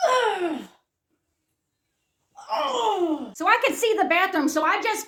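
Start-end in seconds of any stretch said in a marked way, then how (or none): 0.1-3.3 drawn-out groan, sliding down in pitch; acted moan of discomfort; straining groan. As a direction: groan as if barely holding it in. Acts out desperate straining walk.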